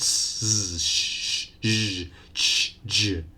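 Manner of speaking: "s, sh, ch, j" hissed out one by one; sibilant sounds demo. Shown as a demonstration. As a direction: push air through each of "s, sh, ch, j". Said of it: "turbulence of air when pronouncing these six sounds".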